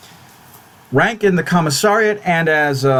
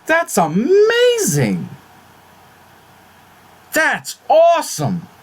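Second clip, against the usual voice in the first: much higher, slow delighted squeal; excited squeal; 13 semitones higher, slower than usual. Real, not acted. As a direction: gush over the gift. Thrilled unboxing flag.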